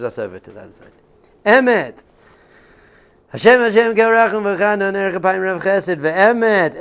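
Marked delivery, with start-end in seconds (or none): 1.4-6.8 loud, high, ringing declaration; proclaiming. Real, not acted. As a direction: call out each name of God high and loud. Proclaiming the divine attributes.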